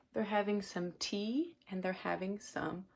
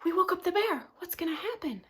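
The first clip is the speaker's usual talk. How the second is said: high worried voices; mock panic, rising high; scared animals; 8 semitones higher, 6 dB louder, faster than usual. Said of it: "They're like".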